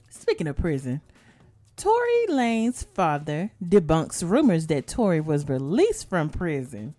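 normal talking voice

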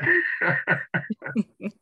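long laugh; loud laugh. Real, not acted. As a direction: laugh freely and loudly. Laughing at her own story.

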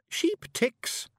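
very high little Scots voice, pondering; thoughtful. A narrator's reading. Little bard finds the comparison, thoughtful. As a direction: offer it as a considered answer. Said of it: "said Billy thoughtfully".

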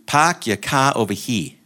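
half-imitated Australian parking attendant, slowed down; Australian accent. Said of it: "this is what I heard the guy say".

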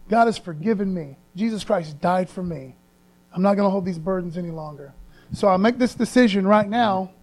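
ordinary talk

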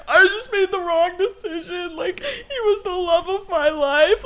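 telling Helen on the train she regrets it; mock tearful wail. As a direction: whine it like a lovesick mess.

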